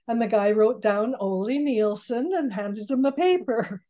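ordinary delivery

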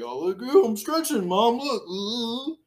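high-pitched, hammed-up fake stretching; bullshit excuse. Stepson fools mom with stretching, high mock-innocent strain. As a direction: badly fake an excuse, voice pushed way up. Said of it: "giving some fucking bullshit stretching excuse".

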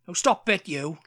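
Welsh accent with glottal stop; playful Welsh accent demo. Shown as a demonstration. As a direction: cut the T in "stop it" short, Welsh style. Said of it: "a little bit of glottal stops".

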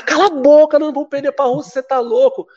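high-pitched, loud mocking retort; a high, incredulous put-down; mocking scoff. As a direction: scoff at him like teammates who think he's crazy. Teammates mocking him, retold.

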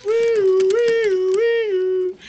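high loud wee-oo ambulance siren; mouth-made ambulance siren, loud; siren imitation. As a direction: mimic a meat wagon siren. Ambulance arriving.

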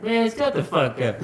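imitated annoyance; irritated aunt. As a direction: cut him off like a fed-up aunt. Aunt shutting him up.

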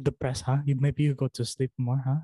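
exaggerated Filipino-mother accent; mom's Filipino accent. Mom quizzing her depressed kid, exaggerated Filipino accent. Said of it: "I just kinda emphasize the accent".

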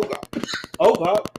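ordinary talk, nothing marked

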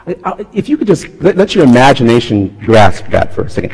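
ordinary talk

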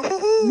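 high, loud Pillsbury Doughboy giggle; a sharp high hoo-hoo like the dough mascot. Retold or imitated. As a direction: squeal the Doughboy's poke laugh. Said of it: "Want me to Pillsbury Doughboy you".

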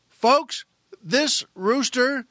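ordinary talk

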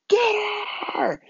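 high-pitched pounce yell; excited attack cry. Retold or imitated. Boy springing a trap with his brothers, high yell. Kids ambush girl they tie up.

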